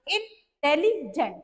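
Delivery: Intelligent spoken slowly to show its syllables; slow syllable demo. Shown as a demonstration. Teacher to students, showing three syllables: slow intelligent. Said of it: "इसमें तीन syllable आए".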